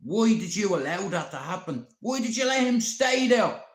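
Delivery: high, loud accusing shout; family going mental. Retold or imitated. Man retelling his family shouting at him, loud and high. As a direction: yell it like angry relatives blaming him. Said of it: "my family yesterday went mental at me".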